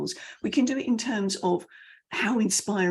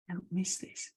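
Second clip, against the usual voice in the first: hushed; 3 semitones lower, 11 dB quieter than usual. Shown as a demonstration. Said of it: "Quiet and fast".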